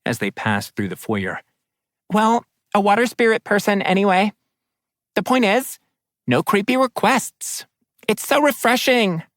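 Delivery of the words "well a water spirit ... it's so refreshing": high, happy water spirit; bubbly spirit voice. Acted. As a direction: bubble over with delight, pitched high. Nerissa keeps chattering.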